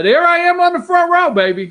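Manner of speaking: high, loud and proud; sustained high-pitched showy call; excited boast. Real, not acted. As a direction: crow it out as you spot yourself. Calls out spotting himself.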